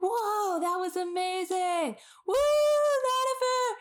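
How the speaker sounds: very high, drawn-out cheering; obnoxious fangirl. Acted. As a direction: whoop like an over-the-top fan from the crowd. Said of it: "Hilrana is obnoxiously like".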